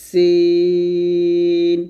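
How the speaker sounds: সিন held long and loud; সিন drawn out, demonstrated; held letter demo. Shown as a demonstration. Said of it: "আর এটা হচ্ছে".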